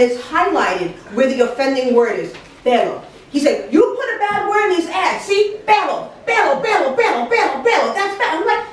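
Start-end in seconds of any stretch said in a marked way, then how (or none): 3.7-5.6 high-pitched angry accusation, acted out; irate boss. Boss finds dirty word in ads, mock outraged tirade. 5.7-7.9 PELO repeated high-pitched and insistent; irate repetition. Retold or imitated. Boss points at offending word, PELO repeated in high mock outrage. 7.9-8.4 high-pitched angry accusation, acted out; irate boss. Boss finds dirty word in ads, mock outraged tirade.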